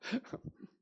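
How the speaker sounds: breathless, unvoiced high giggle; a quick embarrassed laugh. Real, not acted. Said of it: "킥킥해서 죄송합니다".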